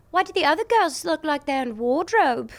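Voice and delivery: high Geordie-style British accent. Shown as a demonstration. Pop star obliging an interviewer's accent request, British accent. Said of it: "can you do a British accent".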